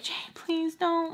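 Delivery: high, soft, slow plea; pleading little brother. Retold or imitated. Brothers begging sister not to fight, soft plea. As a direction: beg quietly in a child's voice.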